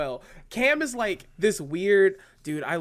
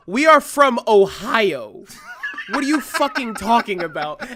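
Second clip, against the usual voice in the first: loud, slow, higher-pitched indignant comeback; indignant retort; 5 semitones higher, 8 dB louder than usual. Retold or imitated. Kim snapping back at Madison's homesick question, loud and indignant. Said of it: "And Kim goes".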